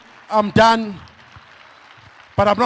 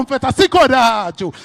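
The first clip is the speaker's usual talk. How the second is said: ordinary talk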